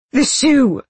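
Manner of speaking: high, loud anguished cry; desperate, falling wail; wailed answer. A narrator's reading. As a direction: wail it out in despair. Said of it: "he wailed".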